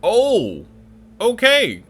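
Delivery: high startled oh okay; surprised. Real, not acted. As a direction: caught off guard, voice jumping up. Sudden high surprised exclamation.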